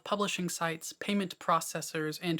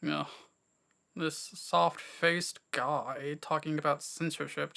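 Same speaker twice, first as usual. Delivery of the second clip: mock the sceptical viewer's scorn; lightly voiced contemptuous commenter; sneering viewer; 2 semitones lower than usual. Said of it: "you may be thinking".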